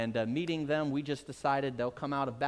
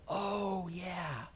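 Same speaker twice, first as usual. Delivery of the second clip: soft, dropped-off realization, much quieter; sudden realization; 9 dB quieter than usual. Retold or imitated. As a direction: let it dawn on you quietly. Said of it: "And then it hit me".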